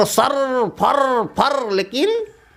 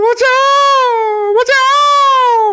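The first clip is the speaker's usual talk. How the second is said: much higher, louder, very slow crying wail; crying for help; 6 dB louder, much slower than usual. Acted. Frightened clod cries for help to the leaf. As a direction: howl for help through tears. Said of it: "ढेला रोने लगा चिल्ला चिल्ला के".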